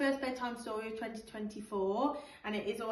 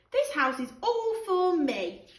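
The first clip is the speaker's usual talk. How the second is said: higher, louder bossy claim; childish possessive declaration; 8 semitones higher, 8 dB louder, slower than usual. Acted. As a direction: stake your claim like a stubborn kid. George claiming the house.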